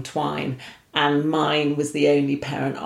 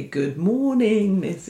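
slow, drawn-out pupil greeting, noticeably higher; mock-formal school greeting; 5 semitones higher, slower than usual. Girl greeting her new strict school teacher, slow and prim. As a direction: chant it like a dutiful schoolgirl to the teacher. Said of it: "stand up, say".

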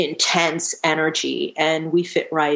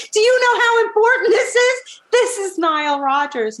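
very high, loud, frantic retold plea; exasperated pleading; 4 dB louder than usual. Singer begging producer to include [name], exasperated. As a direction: act out desperate disbelief at the producer. Acted argument with her producer.

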